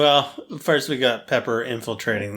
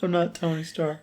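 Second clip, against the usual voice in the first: mock whimper, softer and high; 6 semitones higher, 4 dB quieter than usual. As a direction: whine it like a sulking kid. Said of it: "He said it all whimpering".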